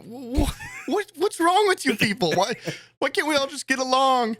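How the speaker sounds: high mock-incredulous whine; asking the teens why they can't get along; bewildered plea. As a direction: play it wide-eyed and put-upon. Retold bewildered reply to insult.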